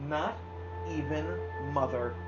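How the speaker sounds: ordinary delivery, nothing marked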